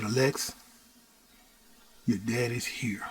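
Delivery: hushed, tender words to the belly; soft to the unborn baby. Retold or imitated. Father softly greeting his unborn daughter, hushed. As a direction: murmur to the baby in the womb.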